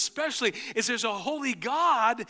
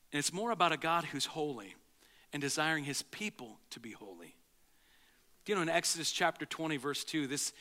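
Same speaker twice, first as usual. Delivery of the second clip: normal talking voice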